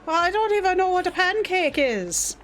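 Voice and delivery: usual speaking voice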